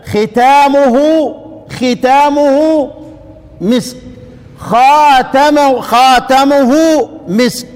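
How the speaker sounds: teacher modelling both readings. Shown as a demonstration. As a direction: say ختامه then خاتمه deliberately. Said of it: "يبقى القراءتين هكذا".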